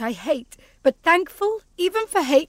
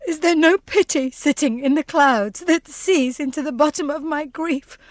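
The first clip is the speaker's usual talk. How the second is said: normal talking voice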